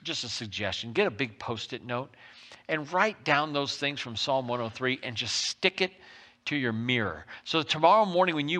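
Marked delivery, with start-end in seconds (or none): none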